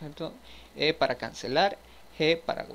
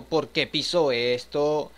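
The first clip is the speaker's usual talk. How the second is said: slow, louder, drawn-out mock exasperation; exasperated whine; 9 dB louder, much slower than usual. Gamer complaining at the character for stepping on it, drawn-out whine. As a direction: stretch it out in disbelief.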